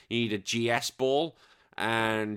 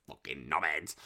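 low, soft muttered insult; mock insult; 8 semitones lower, 8 dB quieter than usual. Host imagining the viewer boasting, muttered mock insult. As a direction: grumble it under your breath. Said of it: "I'm like".

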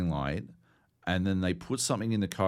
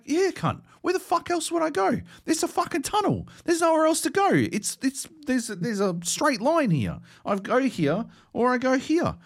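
much higher, louder exasperated yelling at a friend; exasperated outburst; 4 dB louder than usual. Retold or imitated. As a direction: squeal it out in disbelief, as if the answer is obvious. Exasperated retort to his friend.

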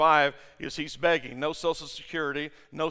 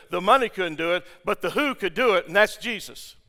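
ordinary delivery

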